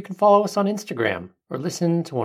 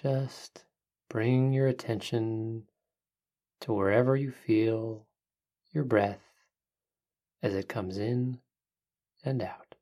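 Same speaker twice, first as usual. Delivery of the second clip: soft spa whisper; 5 dB quieter than usual. Acted. Leads a breathing meditation.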